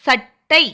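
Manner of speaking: "சட்டை" in two beats with a held retroflex t; doubled consonant demo. Shown as a demonstration. Teacher checking children hear the letter, split-word demo. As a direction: stop on the ட் in "சட்டை" before releasing டை. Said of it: "சத்தம் கேக்குதா?".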